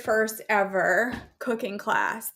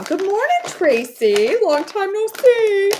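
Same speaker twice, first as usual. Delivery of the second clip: higher, louder squeaky fish voice; cartoon fish greeting, pitched up; fish from Dory; 8 semitones higher, 6 dB louder than usual. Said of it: "I just sounded like the fish in Dory".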